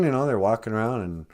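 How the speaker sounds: usual speaking voice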